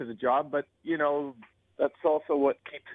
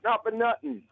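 higher-pitched New York wise guy; New York accent; 5 semitones higher than usual. Shown as a demonstration. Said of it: "can I have a New York".

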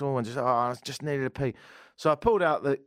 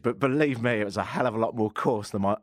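ordinary talk

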